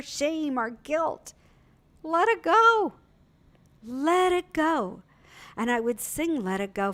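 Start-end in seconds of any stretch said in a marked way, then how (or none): 2.0-2.9 high, loud cry of release; soaring release; let it go. Acted. Dramatic release in sermon. 3.8-5.0 slow let go. Acted. Repeated, slowed release.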